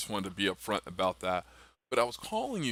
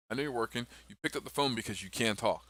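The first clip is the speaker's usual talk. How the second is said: ordinary delivery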